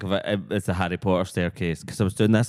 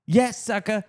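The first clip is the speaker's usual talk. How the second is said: ranting street man; 11 semitones higher, slower than usual. Acted. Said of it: "He goes".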